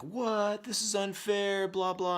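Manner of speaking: high, slow, drawn-out protest; mock indignant kid. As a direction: imitate a kid whining that it's unfair. Parent mimics son's protest.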